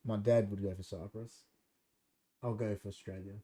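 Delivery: ordinary delivery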